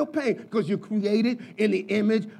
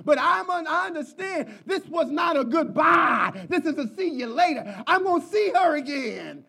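much higher, shouted, preaching with heart; impassioned testimony; 9 semitones higher than usual. Retold or imitated. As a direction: cry out the belief like a grieving pastor. Retelling pastor's passionate testimony.

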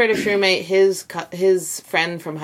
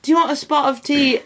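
mock British, pitched up; British accent; 7 semitones higher than usual. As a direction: offer it in a put-on British voice. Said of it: "And now he's British".

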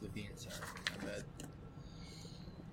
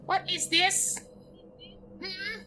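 very high squeaky goblin voice; tittering goblins; 6 dB louder, slower than usual. Acted. Goblins titter over a glowing book, high creature voice. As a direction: squeak like a curious little goblin. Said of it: "The goblins start to titter".

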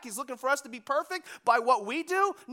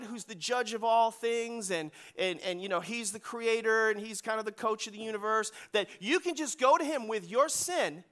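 usual speaking voice

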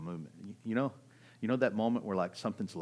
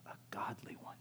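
soft whisper; whispered, weighty answer; hushed reverence; 11 dB quieter than usual. Real, not acted. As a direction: let it drop to a near-whisper. Hushed punchline of his testimony.

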